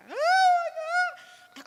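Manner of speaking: very high mock wail; imitated baby cry. Mom mimics special-needs son upset by noise, mock cry. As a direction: wail like a startled baby, put on. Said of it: "he would just go into a cry".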